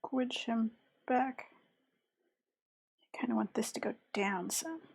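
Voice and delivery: muttering under the breath; half-whispered thinking aloud; quiet narrating. Real, not acted. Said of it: "I stop talking to y'all and start narrating my choices".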